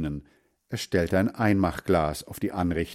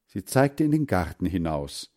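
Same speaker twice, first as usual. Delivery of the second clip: plain reading voice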